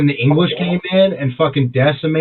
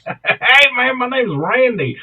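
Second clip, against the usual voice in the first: high, mocking echo of a country cousin; Randy Rutledge; 8 semitones higher, slower than usual. Friend echoes the imitation of the cousin, mock country voice. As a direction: parrot the hillbilly greeting, pitched up. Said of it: "he'll tell you, he's like".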